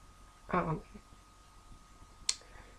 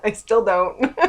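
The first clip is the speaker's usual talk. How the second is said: laughing; 2 semitones higher, 7 dB louder than usual. Real, not acted. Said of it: "I laugh, it's a tick".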